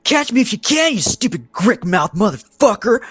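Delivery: shrieking high, loud mocking taunt; taunting gnome. Gnome taunting the guards as she flees, high shrieking mockery. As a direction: cackle the insult back while running off.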